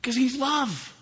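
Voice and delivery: high, loud, impassioned preaching; preacher proclaiming God's love; fiery preaching. Real, not acted. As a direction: preach it with full fire. Preaching at full force.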